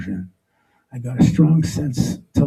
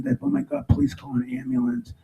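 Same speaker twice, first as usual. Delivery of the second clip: much higher, frantic pleading voice; panicked plea; 3 dB quieter than usual. Retold or imitated. A bystander begs for an ambulance in high panic.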